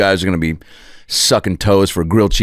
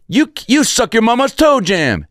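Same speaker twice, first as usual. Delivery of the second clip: much higher jeering taunt; mocking kid taunt. Ham Porter taunting the catcher, high jeering voice. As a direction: fling the insult like a brat.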